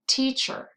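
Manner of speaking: "teacher" with the first syllable stronger; stress on first syllable. Shown as a demonstration. Teacher showing learners the stronger part, first-syllable stress on "teacher". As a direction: lean on the "tea" in "teacher". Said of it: "If you said the first part".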